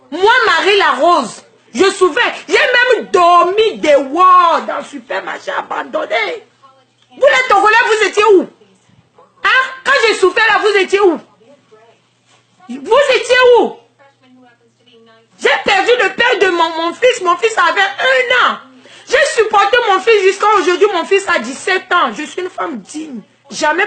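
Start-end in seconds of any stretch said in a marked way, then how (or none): 2.5-13.7 high, loud reproachful shouting; furious accusing rant; angry shouting. Real, not acted. 15.4-20.9 high, fast, agitated pleading; agitated lament; heated pleading. Real, not acted. Emotional plea about her suffering.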